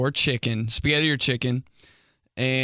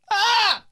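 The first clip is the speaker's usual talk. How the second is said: a sudden high yelp of cringe; embarrassed yelp; 6 dB louder than usual. Real, not acted. Cringing at his own awkward flirting, a high yelp. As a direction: squeal out loud, high and sharp.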